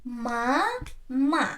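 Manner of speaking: MA, MA as two separate beats; syllable by syllable. Shown as a demonstration. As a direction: split "Ma, ma" with a clear pause between the syllables. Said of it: "divide this word into two syllables".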